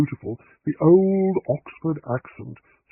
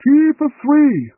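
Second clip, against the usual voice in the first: shout; 14 semitones higher, 8 dB louder, slower than usual. A narrator's reading. Vicar shouting to housekeeper.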